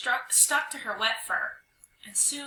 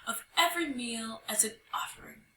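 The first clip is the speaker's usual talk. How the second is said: higher, ghostly intoned decree; authoritative pronouncement; 7 semitones higher than usual. Acted. Young hyena as spirit demanding an offering, with authority. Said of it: "called out with authority".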